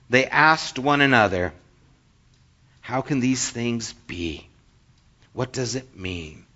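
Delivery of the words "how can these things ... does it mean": softer, lower, wondering questions; hushed perplexity. A narrator's reading. Bewildered crowd asking one another, read in hushed perplexity. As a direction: drop to a hushed, puzzled wonder. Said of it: "Amazed and perplexed".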